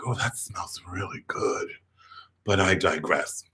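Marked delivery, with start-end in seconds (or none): 0.0-1.7 hushed, breath-only aside; savoring whisper. Retold or imitated. Kid sniffing a new leather glove, savoring whisper. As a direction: whisper it low, drinking in the smell.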